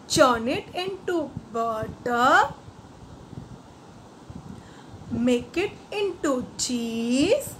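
put-on slow, sing-song rhyme recital for children; nursery rhyme recital. Shown as a demonstration. Teacher reciting the poem slowly to the class, sing-song. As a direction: lilt each line slowly like a nursery rhyme. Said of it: "First, I will say the whole poem".

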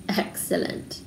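soft whispered delight. A narrator's reading. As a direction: breathe it like a secret. Twins whispering together.

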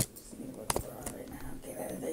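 hushed whisper, breathy and low. Real, not acted. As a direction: whisper it so nobody hears. Hushed urging during sneaking.

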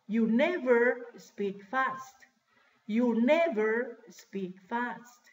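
half-speed, every word set apart; slow model sentence. Shown as a demonstration. Teacher showing class where never goes, slow model sentence. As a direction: stretch it out for language learners.

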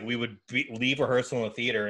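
ordinary talk

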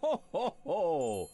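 slow, booming Santa ho ho ho; jolly Santa belly laugh; deep belly laugh. Retold or imitated. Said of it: "your ho ho ho sounded a lot more higher pitched".

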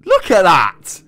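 loud, high exclamation; amazed shout. Real, not acted. Amazed at muscular animal.

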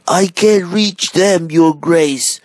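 high, strained yell; calling down. A narrator's reading. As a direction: shout back helplessly from up a ladder. Gardener calls down from ladder.

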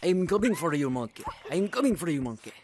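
loud, high-pitched playful threat, dropping on the last word; mock menacing shout. Chasing the other monkeys in a game, mock menacing shout. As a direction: call out a hammed-up hunter's taunt.

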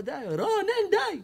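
high pitched mock yell of stop; high mock shout of enough; imitating shouting. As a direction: cut him off, annoyed. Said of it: "אמרתי לו".

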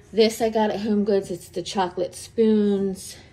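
ordinary delivery

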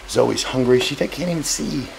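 ordinary talk

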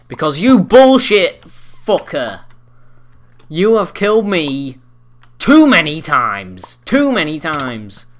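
slow, loud, dragged-out rage; furious rant. Real, not acted. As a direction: bellow it slowly at the screen. Raging at player killing him.

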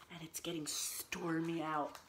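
very soft, ominous storyteller whisper; hushed, low story aside. Acted. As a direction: whisper that a storm is brewing. Storyteller hushes as the storm builds.

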